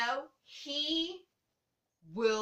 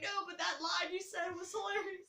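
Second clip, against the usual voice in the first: retelling herself laughing; 8 semitones higher than usual. Retold or imitated. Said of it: "I was like".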